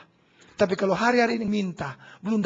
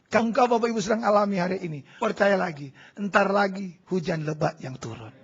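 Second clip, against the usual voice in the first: usual speaking voice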